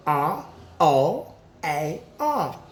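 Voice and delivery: letters spelled out slowly; slow spelling. Shown as a demonstration. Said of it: "What sound is that?".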